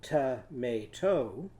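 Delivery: "Tomato" slowly, each syllable apart, rising at the end; slow counting of "Tomato" beats; syllables drawn out. Shown as a demonstration. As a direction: stretch "Tomato" syllable by syllable for the class. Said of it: "How many syllables are in tomato?".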